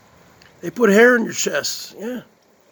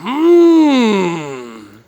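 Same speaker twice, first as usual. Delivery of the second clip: hammed-up long loud yummy moan; loud mock moan of delight; 6 dB louder than usual. Streamer tasting pizza for viewers, loud mock moan. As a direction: overdo the pleasure of the first bite. Exaggerated eating moans.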